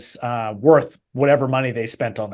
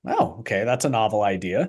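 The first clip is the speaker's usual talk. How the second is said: dry mock surprise; sarcastic; 5 semitones lower, 5 dB quieter than usual. Real, not acted. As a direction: feign being impressed. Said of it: "I'm being quite sarcastic".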